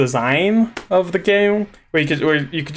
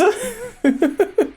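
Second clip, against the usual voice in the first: high giggle. Acted. Laughing at his own joke to viewers, high giggle. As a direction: let a squeaky little laugh escape. Laugh after squeaky line.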